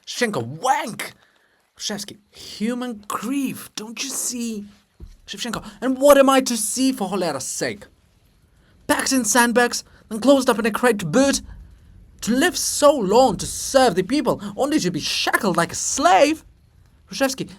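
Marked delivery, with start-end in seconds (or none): 0.4-1.1 angry curse. Acted. Shevchenko curses. 2.3-4.6 slow hushed whisper. Acted. Hrushevsky whispers reply. 5.8-16.4 high loud bitter protest; indignant outburst. Acted. Shevchenko protests to Hrushevsky with a loud indignant outburst.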